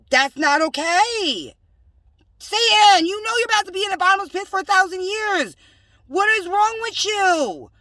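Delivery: high, loud rant; railing at Satan about the pit; loud scolding. Real, not acted. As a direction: let it rise into a tirade.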